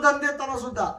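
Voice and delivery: धन said softly, almost to oneself; quiet repeat. Shown as a demonstration. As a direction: drop the repeat to a soft aside.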